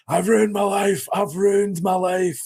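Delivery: high, slow, wailing lament; mock despairing wail. Prisoner imitates the murderer next door, high slow wail. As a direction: ham up a broken man crying out his regret. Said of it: "he's shouting shit out".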